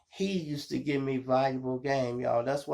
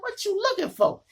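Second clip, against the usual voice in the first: much higher, louder outraged question; indignant question; 5 dB louder than usual. Retold or imitated. To the cop searching him, a high loud indignant question. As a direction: throw it at him in disbelief.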